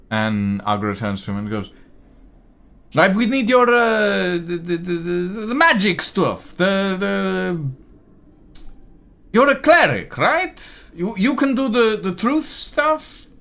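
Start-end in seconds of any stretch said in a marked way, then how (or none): 2.9-13.3 much higher and louder, stammering for the word; a councilman fumbling to ask the cleric for magic; bumbling councilman. Acted. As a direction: flail for the right term, half-embarrassed. Agra fumbling for words.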